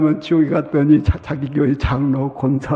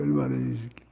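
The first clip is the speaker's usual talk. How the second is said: quiet repeat; 4 semitones lower, 10 dB quieter, much faster than usual. Retold or imitated.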